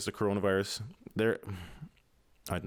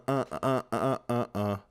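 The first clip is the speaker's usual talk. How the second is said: ordinary delivery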